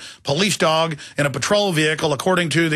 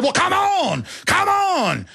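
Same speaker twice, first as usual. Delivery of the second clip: taunting dare; 14 semitones higher, much slower than usual. Retold or imitated. Father daring the dog owner to fight, high taunt. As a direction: dare him to step up and fight.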